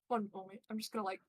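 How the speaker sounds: ordinary talk, nothing marked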